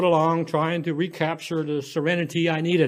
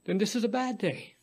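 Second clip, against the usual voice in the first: put-on softer, gentle child's reply; quiet; 5 semitones higher, 6 dB quieter than usual. A narrator's reading. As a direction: say it softly to comfort her. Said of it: "she quietly".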